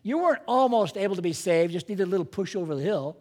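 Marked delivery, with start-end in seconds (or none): none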